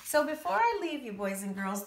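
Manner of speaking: ordinary talk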